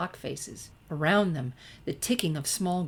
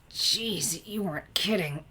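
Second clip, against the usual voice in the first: grunted; 2 semitones higher than usual. Acted. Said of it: "she grunted".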